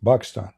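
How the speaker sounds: Pakistan said as "Bakistan", P turned to B; Arabic pronunciation. Shown as a demonstration. Showing viewers how Arabs say Pakistan, B for P. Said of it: "In Arabic, we don't have P".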